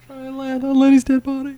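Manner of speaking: high, laughing through it; can't stop giggling. Real, not acted. Giggling at a joke.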